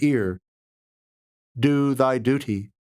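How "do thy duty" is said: ordinary delivery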